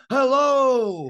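big, high, loud hello; high-pitched showy shout of greeting; exuberant greeting. Acted. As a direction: belt out a cheery hello. Acts out confident podcast greeting now.

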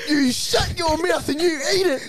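high, loud comic telling-off; mock scolding. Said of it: "did she say?".

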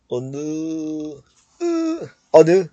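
"آنو" drawn out slowly on its final throaty vowel; drawn-out Korean vowel. Shown as a demonstration. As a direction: stretch the "ا" of "آنو", pushed from the throat. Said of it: "ا که از ته حلق میاد". («آنو» کشیده، با «ا» از ته حلق)

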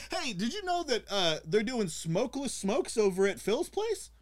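high, slow, lightly voiced sly tip; gossipy tip-off. Agent passing on the rumor, high slow sly voice. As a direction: drawl the tip like spreading gossip. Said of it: "It's like".

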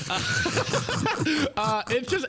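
breaking into laughter mid-sentence; giggling through the words; laughing. Real, not acted. Chuckling while answering the host about the old clip, laughing.

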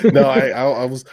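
laughing while answering; giggly, words break into laughter. Real, not acted. Laughs at the cheating question.

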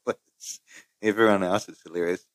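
usual speaking voice

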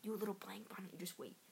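soft menacing whisper; witchy whisper. Acted. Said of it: "it'd be like a blank".

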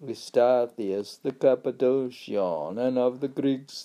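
high, loud chant-like reading, easing down; intoned reading aloud. Acted. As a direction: declaim the old text on a held high note.

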